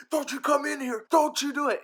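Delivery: high, loud mock threat; hammed-up warning to an intruder; stern warning. Owner silently warning the cat, loud mock threat. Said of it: "in my head, I'm like".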